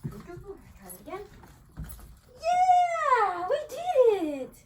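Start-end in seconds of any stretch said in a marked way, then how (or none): 2.4-4.5 very high, loud, stretched yeah; squealing joy with the dog; ecstatic cheer. Real, not acted. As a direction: celebrate like a win. Celebrating success with dog.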